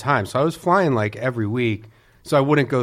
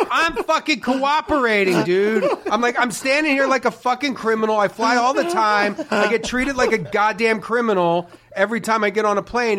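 high, heated, laughing rant; mock angry rant; 8 semitones higher than usual. Retelling arguing with the airport cop, high angry rant. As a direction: rail at him, half laughing.

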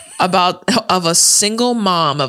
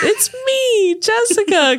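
much higher, squeaky; slower than usual. Retold or imitated. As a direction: pitch it way up like a mock girl. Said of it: "someone had put".

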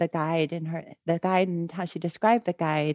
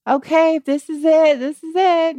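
high, loud, rising to release; ecstatic surrender; 11 semitones higher, 10 dB louder than usual. Retold or imitated. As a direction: cry out in joyful letting go. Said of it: "and she's just".

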